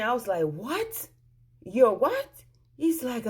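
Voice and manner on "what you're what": rising questions, the second louder; retold stunned double take; high incredulous echo; shocked disbelief. Learns husband is married.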